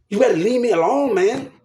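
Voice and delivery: usual speaking voice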